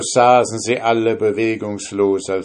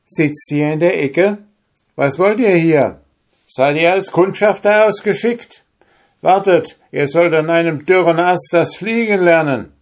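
higher, louder menacing taunt; robbers threatening; 10 semitones higher, 6 dB louder than usual. Acted. Robbers threatening the soldiers they found, loud and menacing. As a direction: threaten the hidden men.

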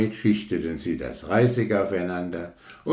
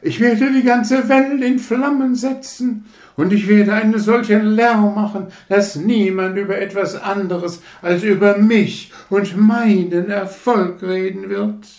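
prahlerische Rakete; 8 dB louder than usual. Acted. Rakete prahlt laut weiter.

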